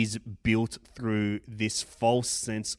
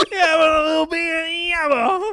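much higher, louder ocker Australian voice, consonants dropped; broad Australian accent; 8 dB louder than usual. Retold or imitated. Mocking the dirty dog Australian accent, laughing at it. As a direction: ham up a thick, grubby bush Australian. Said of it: "dirty dog Australian accent".

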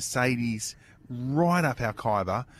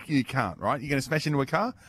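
ordinary talk, nothing marked

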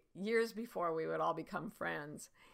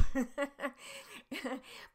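real chuckling laugh after the punchline; amused laugh; 7 semitones higher than usual. Real, not acted. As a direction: laugh along at your own story. Laughs at her own anecdote.

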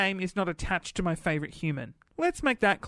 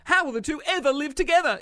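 higher, fast, through laughter; real amused laugh; 7 semitones higher, 4 dB louder, faster than usual. Real, not acted. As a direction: giggle through the question. Laughs at own joke.

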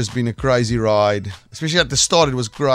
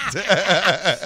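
bashful higher laugh; 6 semitones higher than usual. Real, not acted. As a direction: laugh it off, caught out. Guest laughs at the exclusive.

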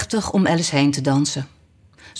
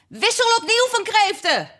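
very high excited yell; Gryphon's high, acted scream. A narrator's reading. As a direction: shriek the next step out high. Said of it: "gilde de Griffioen".

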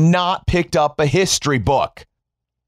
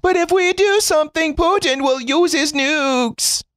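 whiny mock voice; 14 semitones higher than usual. Mocking the government's fearful excuse, high whiny voice. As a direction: mimic the timid excuse in a high whine.

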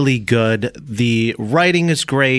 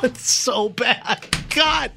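laughing; 11 semitones higher, 4 dB quieter, much slower than usual. Real, not acted. Laughing at the bad take.

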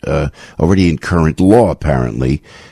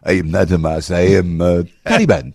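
mock Taliban accent. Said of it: "is that a Taliban accent?".

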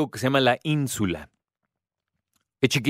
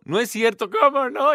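higher, lightly voiced teary protest; a hint of a little girl about to cry; teary child; 10 semitones higher than usual. Girl told the pigs will be killed, protesting in a higher teary voice. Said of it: "con ojito lloroso".

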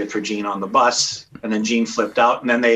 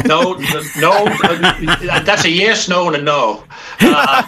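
laughing high; 7 semitones higher, 4 dB louder, slower than usual. Real, not acted. Laughing denial of the question.

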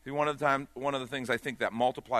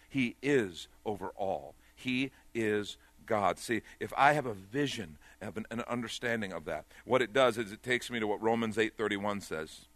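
normal talking voice

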